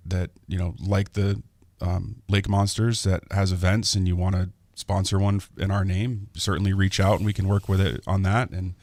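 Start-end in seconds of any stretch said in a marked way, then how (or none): none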